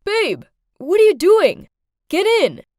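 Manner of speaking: loud, high shout across the street; yelled call. A narrator's reading. Scary-looking guy calls a babe over, yelling. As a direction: yell it out to get their attention. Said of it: "yelled at".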